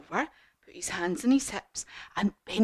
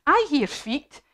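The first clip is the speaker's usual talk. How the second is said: higher, lightly acted alert; watchful Gruffalo; 7 semitones higher, 4 dB louder than usual. Gruffalo hearing someone on the path, alert announcement. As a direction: announce it as a lightly voiced monster. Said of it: "the Gruffalo says".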